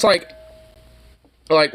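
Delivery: normal talking voice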